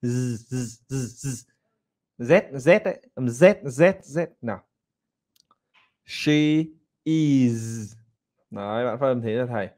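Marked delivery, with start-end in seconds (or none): 0.0-2.8 repeated "z" with a long voiced buzz; buzzing z sound. Shown as a demonstration. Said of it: "Âm S phát âm là z". 6.0-7.9 "She ... is" pulled apart with a pause between; slow model of "She is". Shown as a demonstration. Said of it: "Thì phải chậm thế".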